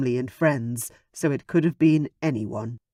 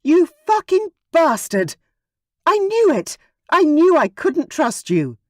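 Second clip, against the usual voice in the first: screaming, much higher and louder, raw rage; furious scream; 13 semitones higher, 7 dB louder than usual. A narrator's reading. Betrayed daughter screaming at the absent mate, furious scream. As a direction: scream the betrayal at the top of your lungs. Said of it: "screamed out loud".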